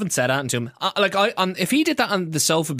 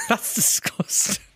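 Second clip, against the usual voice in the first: slow, higher words lost in laughter; helpless laughing; 7 semitones higher, much slower than usual. Real, not acted. Co-host appalled by the innuendo, laughing helplessly. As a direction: squeeze it out while cracking up.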